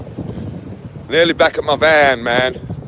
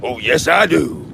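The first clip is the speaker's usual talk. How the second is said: ordinary talk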